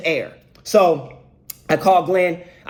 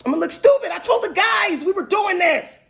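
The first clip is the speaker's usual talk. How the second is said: panicked exclamation; 12 semitones higher than usual. Retold or imitated. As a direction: climb into a high, panicked wail. Panics about losing face with guys.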